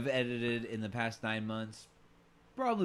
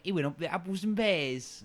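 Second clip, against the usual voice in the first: mock British; 7 semitones higher, 4 dB louder, slower than usual. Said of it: "they do the British speak where they're like".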